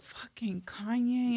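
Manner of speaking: breathy whispered curse under her breath; whispered dread. Retold or imitated. [name] dreading [name]'s reaction, whispered curse. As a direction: whisper it like you fear being overheard.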